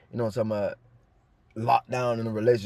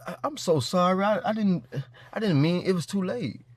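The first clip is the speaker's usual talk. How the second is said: higher, stammering fake sorry; mock pitiful stammered apology, higher; 5 semitones higher than usual. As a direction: play the ex begging back with a mock quiver. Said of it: "come back looking pitiful".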